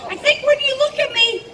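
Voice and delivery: normal talking voice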